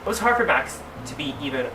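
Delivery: ordinary delivery, nothing marked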